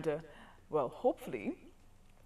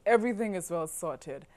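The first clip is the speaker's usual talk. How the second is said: usual speaking voice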